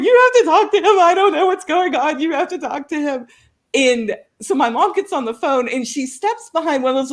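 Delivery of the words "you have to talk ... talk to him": high, urgent begging that drops away; mock-distraught, high-pitched pleading; imitated frantic plea. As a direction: act out an upset daughter passing on the phone. Crying daughter hands phone to mom.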